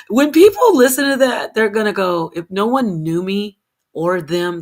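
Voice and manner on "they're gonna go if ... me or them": normal talking voice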